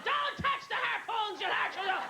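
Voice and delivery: high, slow, frantic mock yell; a hammed-up father shrieking a warning from the doorway; father screaming. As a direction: yell it high and stretched like a panicked dad. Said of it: "My father's screaming".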